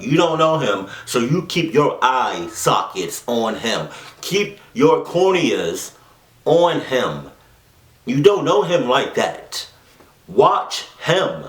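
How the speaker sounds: high, emphatic telling-off in jest; hammed-up exasperated warning; mock scolding. Acted scolding of the story's narrator.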